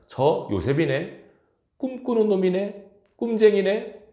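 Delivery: high taunting calls of the dreamer; high, mocking taunt of brothers spotting Joseph; mocking sneer. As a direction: jeer at the little brother from afar.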